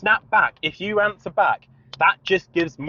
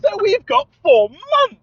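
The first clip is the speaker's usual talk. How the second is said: higher, louder, slowly drawn-out sarcasm; mock realization; 6 dB louder, slower than usual. Telling the sales guy, sarcastically higher and louder. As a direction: savour the irony slowly.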